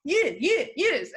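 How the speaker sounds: put-on a light high Russian accent; quick eager Russian-accented yes. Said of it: "can you still do a Russian accent?".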